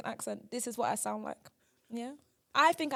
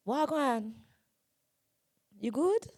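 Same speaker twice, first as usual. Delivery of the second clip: careful Jamaican greeting; attempted Jamaican accent; slower than usual. Shown as a demonstration. Trying the accent for a friend, tentative Jamaican. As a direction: try out a casual Jamaican accent. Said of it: "Okay, let me try that".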